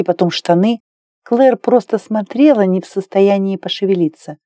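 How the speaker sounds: ordinary delivery, nothing marked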